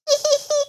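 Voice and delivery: squeaky group giggle; high giggle. Acted. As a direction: giggle like happy piglets. Everyone laughs.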